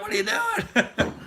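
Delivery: very high, slow, laughing mock of Johanna. Imitating Johanna's startled protest while she laughs. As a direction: squeak it out through laughter. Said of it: "she was like".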